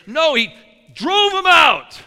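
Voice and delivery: ordinary delivery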